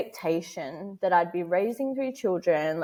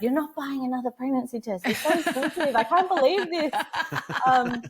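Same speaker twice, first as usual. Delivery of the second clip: higher, laughing mock exasperation; exasperated husband; 8 semitones higher than usual. Wife imitating husband refusing another pregnancy test, mock exasperated. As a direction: play the fed-up husband, half laughing.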